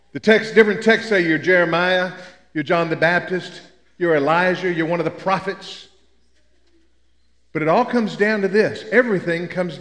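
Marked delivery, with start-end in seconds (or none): none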